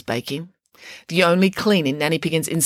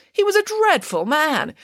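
high, disdainful put-down; scornful; 11 semitones higher than usual. Acted. Nanny Piggins scorning King Midas to the children, high disdain. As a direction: sneer at the king.

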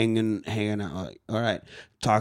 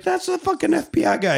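much higher, alarmed blurt. Retold or imitated. Woman warning about the FBI guy, pitched high. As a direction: squeak it out in alarm. Voicing Anna Kendrick's alarmed line.

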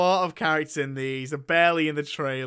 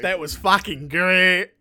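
put-on slow, louder botched Australian accent; Australian gone Scottish; 3 semitones higher, 7 dB louder, much slower than usual. Mocking a friend's film verdict, Australian accent gone Scottish. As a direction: try Australian and slip into Scottish. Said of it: "He went Scottish".

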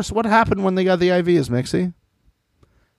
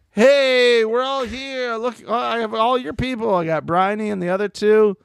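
cheery host voice; 8 semitones higher than usual. Pretend. Voicing the host, high.

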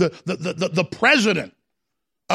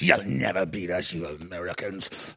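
demon voice; 10 semitones lower, 6 dB quieter than usual. Retold or imitated. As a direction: drag the words down low like a demon. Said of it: "He actually talked like a demon".

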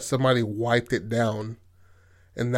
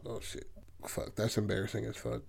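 much softer muttered cringe to herself; embarrassed; 13 dB quieter than usual. Retold or imitated. Kristen dismissed by Kevin, muttering much softer in embarrassment. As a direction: say it under your breath, mortified.

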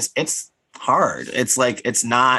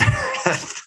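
high squeal laugh. Real, not acted. Laughing mid sentence.

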